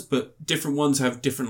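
ordinary delivery

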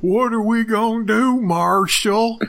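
high-pitched mock Western movie voice; mock cowboy impression. Podcaster mimics a townsman appealing to the marshal, mock cowboy impression.

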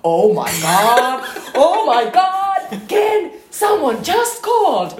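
high, loud, slow mock scream of alarm; overacted high panic yell; hammed-up panicked shout. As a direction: shriek it like a frantic fake emergency. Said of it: "pitelee puhelinta ja huutaa".

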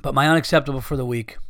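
ordinary talk

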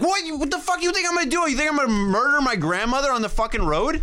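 can't believe what he's hearing; incredulous. Retold or imitated. Son answering dad, high fast disbelief. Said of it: "I'm like".

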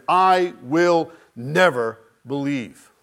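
slow, heavy I will never believe; emphatic refusal. Retold or imitated. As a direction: draw out the refusal with weight. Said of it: "he is emphatic about that".

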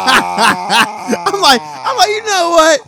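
high, loud, drawn-out mock outrage; squealing mock indignation; mock vengeful glee. Mock vengeful quote about character.

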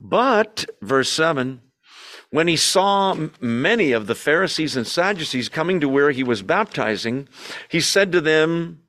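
ordinary talk, nothing marked

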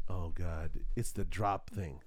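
low, sinking realisation; sinking dread. Retold or imitated. Dad works out it's the drop thing, sinking dread.

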